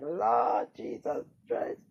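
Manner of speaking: low, drawn-out appeal to heaven; pained exclamation. Retold or imitated. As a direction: groan it slowly, deep in the voice. Reliving shock of pain.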